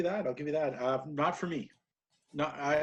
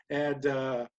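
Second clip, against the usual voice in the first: ordinary talk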